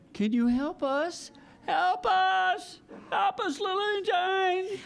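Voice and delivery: high pleading cries for help; calling for help. Acted. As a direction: call out to the passing engine.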